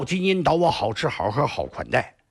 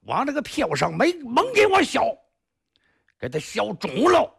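raised, commanding shout of an enraged master; angry command; 7 semitones higher than usual. Acted. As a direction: bark the order to drag them out and beat them. 二侠 orders disciples to beat them.

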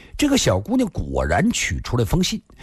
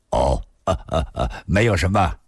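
low, offhand chuckle; low-voiced man laughing it away; chuckling brush-off; 6 semitones lower than usual. A narrator's reading.

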